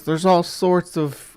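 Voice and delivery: ordinary talk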